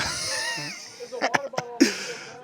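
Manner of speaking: high wheezy laugh; amused laugh. Real, not acted. Commentator cracks up at his Spanish, helpless laugh. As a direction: break up laughing at your own attempt.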